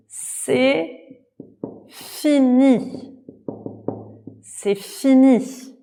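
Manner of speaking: high, slow troll voice; a strange creature announcing it's done. A narrator's reading. Troll voice in story. (voix de troll aiguë et lente)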